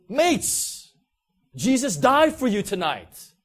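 high, loud call to a crowd; yelled street-preacher announcement; shouted proclamation. Retold or imitated. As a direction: shout it out so a whole street can hear. Friend yells to crowd from truck.

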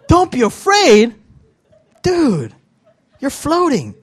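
high, loud disbelief; incredulous retort. Acted. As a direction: throw it back as an astonished question. Incredulous reply to the quote.

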